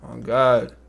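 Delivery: usual speaking voice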